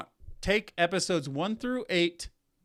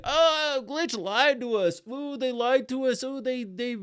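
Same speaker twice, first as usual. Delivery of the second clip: whiny complaint; 5 semitones higher than usual. Retold or imitated. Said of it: "they're gonna complain like".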